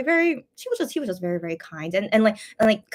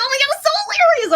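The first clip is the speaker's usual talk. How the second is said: much higher, louder delighted cry; retelling her own delight at the hot dog answer; delighted squeal; 7 dB louder than usual. Retold or imitated. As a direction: squeal it like the joke floored you. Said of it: "laughing so much".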